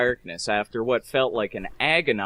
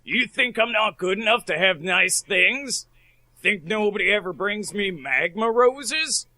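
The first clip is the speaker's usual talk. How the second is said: offended father; 8 semitones higher than usual. Acted.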